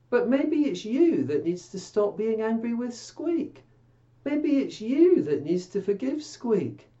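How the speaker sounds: normal talking voice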